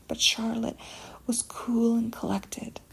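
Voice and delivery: usual speaking voice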